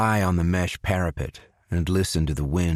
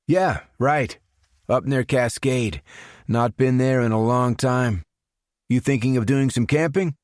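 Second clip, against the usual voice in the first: higher, louder, easygoing mechanic voice; a cheerful buddy making small talk; friendly coworker; 6 semitones higher, 3 dB louder than usual. A narrator's reading. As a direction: Jackson chats casually with Joe, upbeat and louder. Jackson voiced by narrator.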